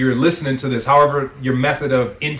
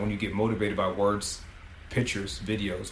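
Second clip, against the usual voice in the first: ordinary delivery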